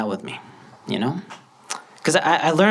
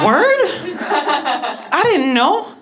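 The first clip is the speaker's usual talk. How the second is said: amazed and delighted at the news; surprised; 12 semitones higher, slower than usual. Retold or imitated. Surprised to be included.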